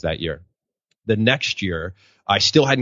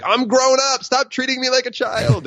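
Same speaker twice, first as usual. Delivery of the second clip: put-on much higher, indignant child protesting to parents; whiny kid. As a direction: whine it like a fed-up kid.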